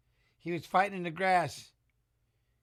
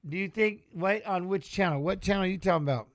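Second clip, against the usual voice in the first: ordinary delivery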